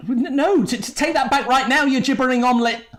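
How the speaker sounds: appalled, high-pitched telling-off; exasperated scolding. Acted. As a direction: splutter an urgent order to retract it. Exasperated adviser scolds bungling speaker.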